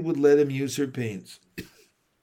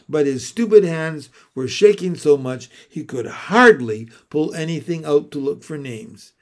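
usual speaking voice

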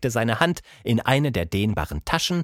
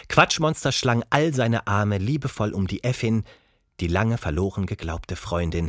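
usual speaking voice

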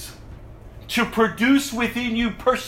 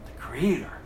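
whisper; 8 semitones lower, 8 dB quieter than usual. Acted. Quoting scripture, voice drops.

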